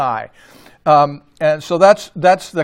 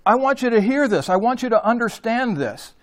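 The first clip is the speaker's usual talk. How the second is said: higher, urgent, pressing the listeners to hear; emphatic voicing of Jesus; 7 semitones higher than usual. Preacher voicing Jesus's call to listen, higher and urgent. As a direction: lift the voice and plead for attention. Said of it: "that's what Jesus is saying here".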